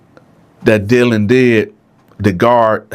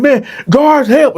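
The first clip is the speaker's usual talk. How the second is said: high, strained cry for help; calling for help. Retold or imitated. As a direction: yell for the guards. Dylan calling for guards.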